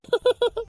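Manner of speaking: high little burst of giggles. Acted. Friend giggles at the newlywed being teased, high giggle. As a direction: break into a short giggle. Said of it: "என்ன சிரிப்பு உனக்கு".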